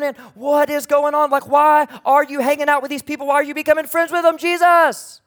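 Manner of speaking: high, loud grumbling that climbs to the last word; indignant complaining. Acted. Religious leaders complain to Jesus about his friends, indignant complaining. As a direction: build the outraged protest, peaking on the name. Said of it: "they are grumbling and they're complaining".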